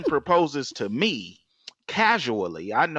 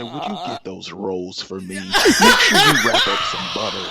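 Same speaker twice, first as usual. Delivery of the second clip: laughing; 4 semitones lower, 5 dB louder than usual. Real, not acted. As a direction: laugh it out, louder at the end. Asking waiter for rolls, laughing.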